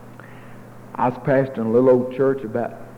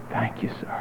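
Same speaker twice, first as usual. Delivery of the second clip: hushed whispered thank you sir; 9 dB quieter than usual. Retold or imitated. Preacher quietly echoing the thanks in a whisper. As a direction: breathe it out softly, almost to yourself.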